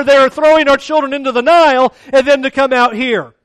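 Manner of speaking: loud, high, complaining mock whine; preacher mocking the complaining Israelites, loud whine; whining Israelites. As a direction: whine like a grumbling crowd in the desert. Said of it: "they are complaining about everything".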